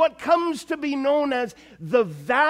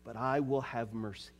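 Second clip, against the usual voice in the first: very soft, tender closing words; barely above a breath, full of mercy; hushed promise; 13 semitones lower, 13 dB quieter than usual. Acted. Preacher softly speaking God's promise of mercy.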